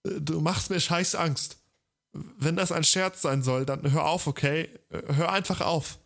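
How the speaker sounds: high, scared begging; terrified pleading hostage. Acted. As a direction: plead with him, voice shaking with fear. Terrified brother pleads through door.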